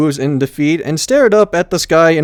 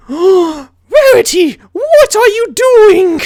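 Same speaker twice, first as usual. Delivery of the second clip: very high, slow, louder aghast voice; appalled pony; 6 dB louder, much slower than usual. A narrator's reading. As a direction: drag it out in disbelief. Twilight shocked.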